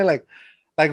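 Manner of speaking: usual speaking voice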